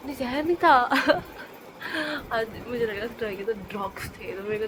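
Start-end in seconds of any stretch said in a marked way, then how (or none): 0.8-2.3 a quick amused laugh that falls away; short laugh. Real, not acted. Talking to viewers about her medicine, short amused laugh. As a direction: laugh at your own silly idea.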